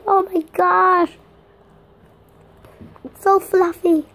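high, loud, delighted gushing; unboxing a gift from mom, real loud delight; thrilled squeals. Real, not acted. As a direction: gasp and gush like it's the best gift ever.

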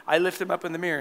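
normal talking voice

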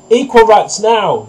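high, loud, slow protest chant; storybook goose's drawn-out yell. A narrator's reading. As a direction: yell it like a picket-line slogan. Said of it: "yelled the goose".

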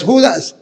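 "Judas" stressed on JU, ending in S; unaccented, final S. Shown as a demonstration. Priest contrasting the name for his uncle, first-syllable stress. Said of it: "con S y sin acento".

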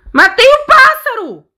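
very high, slow victory cry; triumphant shout. Real, not acted. Triumph in game.

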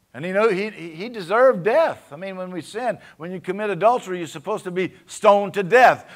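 loud, high, impassioned preaching; full-force preaching. Acted. Pastor fires up the church about sin and mercy, loud high preaching. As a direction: build it to a shouting climax.

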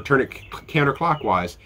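normal talking voice

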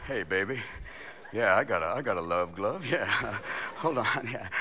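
low, soft bedroom voice; seductive lover. Acted. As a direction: murmur smoothly to a lover. Acted seduction while finding a condom.

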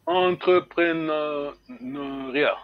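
Entrepreneuriat spelled out slowly, syllable by syllable; careful pronunciation. Shown as a demonstration. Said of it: "c'est comment on prononce ça?". (Entrepreneuriat, prononcé très lentement)

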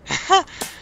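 high, short delighted laugh; gleeful laugh. Real, not acted. Player breaking the bike, short gleeful laugh. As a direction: burst out laughing at the wreckage.

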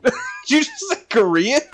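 real laughing fit. Real, not acted. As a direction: crack up mid-question. Cracking up at a mishearing.

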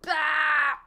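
loud, high, sudden yell; startled scream. Real, not acted. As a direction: yell out in alarm. Startled during game.

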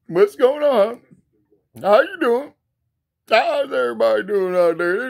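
high, loud hyped-up Willie voice; Willie character voice. Acted. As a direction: bounce in as an over-excited Willie. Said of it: "I'm Willie".

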